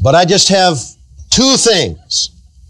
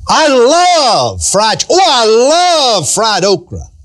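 gushing over food; 5 semitones higher, much slower than usual. Pretend. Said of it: "talking like that".

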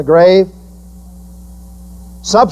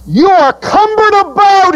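Jesus' rebuke. Retold or imitated. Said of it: "he said".

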